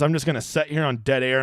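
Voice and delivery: ordinary delivery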